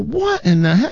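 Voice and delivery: high, incredulous exclamation; rising disbelief at the find; startled doctor. Acted. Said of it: "He's like".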